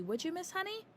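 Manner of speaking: a hint of a small girl asking; Matilda's voice. A narrator's reading. Matilda voiced in read story.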